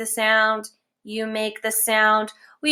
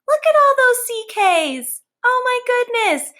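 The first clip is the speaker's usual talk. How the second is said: excited; 13 semitones higher, 4 dB louder than usual. Acted. Teacher marvels at the words.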